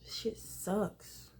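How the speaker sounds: hushed unvoiced grumble; whisper. Real, not acted. Venting alone, whispering in frustration. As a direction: whisper it to yourself, fed up.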